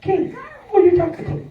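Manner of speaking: half-playful accusing call-out; mock confrontation.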